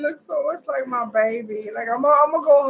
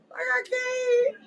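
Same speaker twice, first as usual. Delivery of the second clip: high, slow overwhelmed wail; real distressed high-pitched plea; 13 semitones higher than usual. Real, not acted. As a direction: draw it out high, unable to cope. Overwhelmed viewer dreading the scene.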